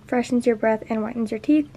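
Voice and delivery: normal talking voice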